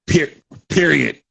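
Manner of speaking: high, loud put-on aunt voice; Aunt Jemima voice. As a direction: squeal period like a sassy aunt. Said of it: "That voice is Aunt Jemima".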